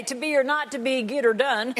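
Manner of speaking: Shakespeare in a Texas twang; Texas accent. Acted. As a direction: play a Texan mangling Hamlet. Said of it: "from Texas, too, so we'd say".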